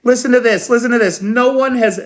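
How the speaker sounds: high, eager listen to this; excited call for attention. Real, not acted. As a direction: grab the audience, excited. Excited about reading a quote.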